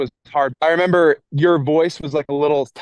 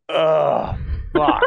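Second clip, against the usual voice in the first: frustrated groan; 2 semitones higher than usual. Retold or imitated. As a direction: groan it out in defeat. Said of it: "both of us going like".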